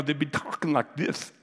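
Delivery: mock tongue-tied speech; pierced-tongue speech. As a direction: talk as if your tongue were pierced. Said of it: "their tongue pierced with a hot iron".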